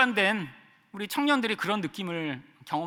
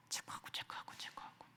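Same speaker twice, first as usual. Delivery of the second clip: whispered checking; 23 dB quieter than usual. Retold or imitated. Ticking boxes.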